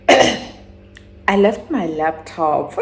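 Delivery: drawn out, heavy and slow; slow dramatic reveal. Real, not acted. To her viewers, realising she lost her laptop, slow and heavy. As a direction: let the bad news land slowly.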